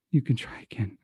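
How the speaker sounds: whispered, low and weak; barely audible. Acted. Francois turned from the group, barely audible whisper. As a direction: say it so they barely hear. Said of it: "You can barely hear Francois say".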